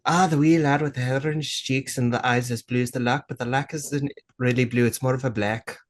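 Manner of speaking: light attempt at a Scottish accent; a hint of a Scottish lilt; lightly put-on Scottish accent. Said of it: "I can do a little bit of a Scottish accent".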